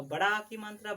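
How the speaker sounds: usual speaking voice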